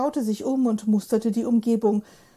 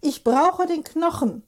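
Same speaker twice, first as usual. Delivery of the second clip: urgent plea; 6 semitones higher, 4 dB louder than usual. Acted. Hubert pleads with the old lady.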